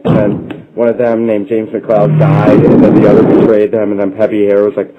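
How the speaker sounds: ordinary delivery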